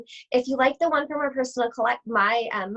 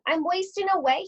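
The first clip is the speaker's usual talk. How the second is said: wolf complaining; 7 semitones higher than usual. Acted. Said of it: "he complained".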